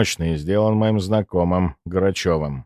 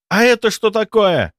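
high surprised exclamation; exclaiming; 12 semitones higher, 4 dB louder than usual. Acted. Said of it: "воскликнул Сеня".

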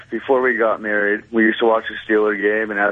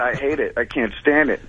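ordinary delivery

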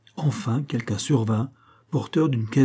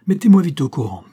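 loud, raised voice of command; authoritative; 5 semitones higher, 7 dB louder than usual. A narrator's reading. Police chief demanding to be briefed, authoritative. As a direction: demand a report with firm authority. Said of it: "de sa voix autoritaire".

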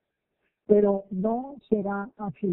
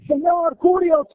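high, loud cry of Señor curios; ringing, exalted address; reverent cry; 8 semitones higher, 9 dB louder, slower than usual. Acted. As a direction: call out the title with awe. Centurion addresses Jesus as Caesar.

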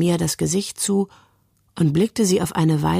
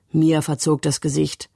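ordinary delivery

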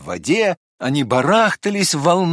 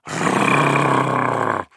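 dog growl. A narrator's reading. Ava the dog growls at Barmaley, rolled growl. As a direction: growl to scare him off. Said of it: "грозно сказала".

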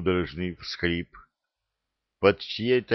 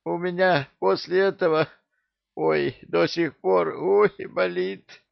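groaning in pain; 10 semitones higher than usual. A narrator's reading. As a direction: moan it out, aching all over. Sore ghoul groans with pain.

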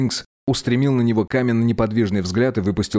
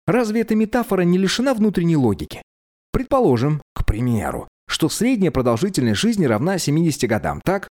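put-on higher, quick-witted young voice; clever young man; 7 semitones higher than usual. A narrator's reading. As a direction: voice a clever young prince arguing. Narrator voices Teppic.

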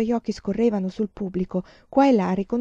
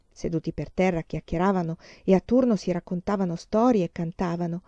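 plain reading voice

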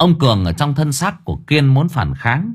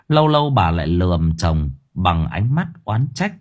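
usual speaking voice

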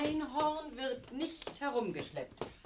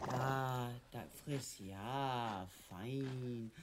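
lower, much softer coaxing; 11 semitones lower, 9 dB quieter, much slower than usual. Acted. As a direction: coo at the animal as it eats. Mutter füttert das Einhorn zärtlich. (leise, lockende Stimme zum Tier)

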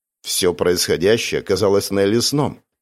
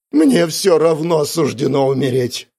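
high, slow despairing wail; 6 semitones higher, 4 dB louder than usual. A narrator's reading. Miss Spencer cornered by her interrogator, wailing. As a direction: draw it out in hopeless lament. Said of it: "завыла мисс Спенсер".

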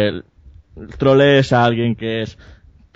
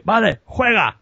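higher put-on voice, grudgingly giving in; imitated big arcade guy; 10 semitones higher, slower than usual. Arcade guy letting the boy play, high grudging voice. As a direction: grant it like a reluctant tough guy. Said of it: "Y dice".

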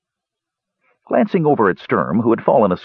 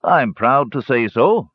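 slow, higher, proud declaration; earnest, measured pride; serious pride; 6 semitones higher, slower than usual. A narrator's reading. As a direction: state it slowly and solemnly. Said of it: "said Dugan seriously".

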